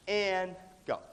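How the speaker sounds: ordinary talk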